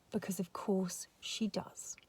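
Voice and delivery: slow unvoiced aside; whispered aside. Real, not acted. Vlogger's whispered aside to viewers. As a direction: whisper it like a secret. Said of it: "aside whispered".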